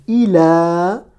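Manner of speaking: "il a" with the final a held long; "il a" drawn out as ilaaa; long alif. Shown as a demonstration. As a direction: stretch the closing vowel of "il a". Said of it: "comme la voyelle longue alif".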